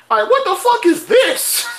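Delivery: very high, incredulous outburst; high-pitched shocked protest; exasperated disbelief. Real, not acted. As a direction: squeal it in baffled outrage. Exclaims over the show's brutality.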